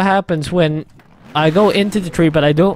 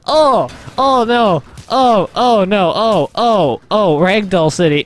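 raised cries with each tumble; delighted horror, calling out each hit; excited alarm; 5 semitones higher, slower than usual. Real, not acted. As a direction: react live to every bump of a crash. Reacting to tumbling ragdoll.

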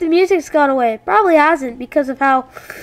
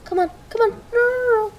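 high, soft pleading; anxious pleading; 6 semitones higher, 6 dB quieter than usual. Real, not acted. Player begging the game not to drop him, anxious pleading.

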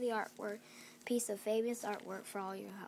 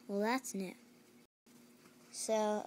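ordinary delivery

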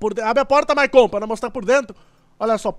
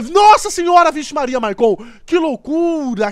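excited outburst; 2 semitones higher, 7 dB louder than usual. Real, not acted. Car hits a guardrail in game.

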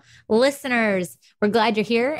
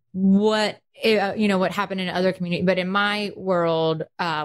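ordinary talk, nothing marked

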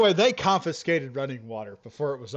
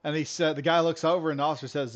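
normal talking voice

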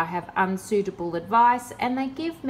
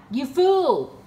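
scornful jeer; 5 semitones higher, 5 dB louder than usual. Real, not acted. Scoffing at scammer's claim.

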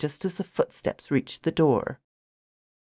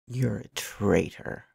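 much lower and softer, slow; 6 semitones lower, 9 dB quieter than usual. A narrator's reading. Alex condemning the woman, low and soft. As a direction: growl it low and quiet. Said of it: "in a low voice".

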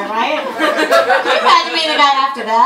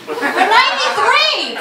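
amazed; 11 semitones higher, slower than usual. Acted. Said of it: "marvelling at grandpa's age".

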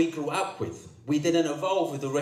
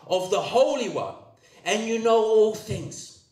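raised, high-pitched sermon delivery; emphatic preaching; 6 semitones higher than usual. Real, not acted. Preacher quoting John to the churches, emphatic preaching.

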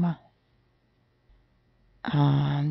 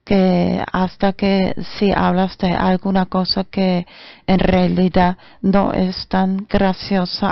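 ordinary talk, nothing marked

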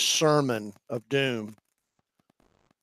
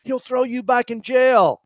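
mock warning; 9 semitones higher, 5 dB louder than usual. Aside voicing a warning to Jeremiah.

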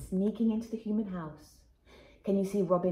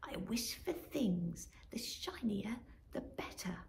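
greedy whispered wish; 7 dB quieter than usual. Acted. Magpie whispers his greedy wish to himself. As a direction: whisper the wish with eyes shut tight. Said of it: "squawked to himself".

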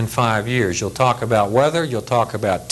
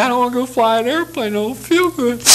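high, whining excuse to the boss; whiny sick pilot; 10 semitones higher than usual. Retold or imitated. Pilot whining to his squadron commander that he feels sick. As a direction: plead off flying like a sulky kid.

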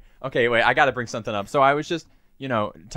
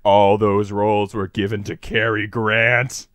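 slow, weighty pronouncement; solemn angel; 4 semitones lower, 3 dB louder, slower than usual. Acted. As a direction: draw it out like a verdict from above. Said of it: "and he goes".

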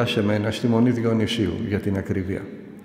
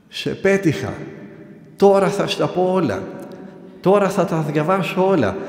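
raised, taunting mock shout; retold shout; 6 semitones higher than usual. Possessed man taunting the nephew at the window, mock shouting. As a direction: call it out like a gloating madman. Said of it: "και να φωνάζει".

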